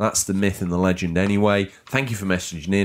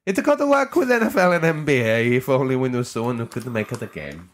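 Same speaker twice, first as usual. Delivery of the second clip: put-on Italian accent; 3 semitones higher than usual. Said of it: "read it in the Italian accent".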